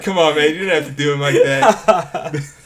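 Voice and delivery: Aussie mock complaint through laughter; Australian accent, laughing. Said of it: "I like the Australian accent".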